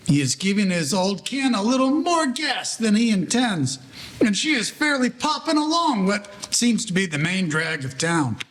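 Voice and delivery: ordinary delivery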